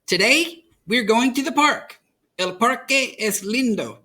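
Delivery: a gentle lift for grandma's words; grandmother's voice. A narrator's reading.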